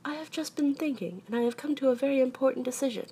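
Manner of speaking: high, emphatic storybook bear; decided Pooh. A narrator's reading. Pooh announces his important decision, high emphatic voice.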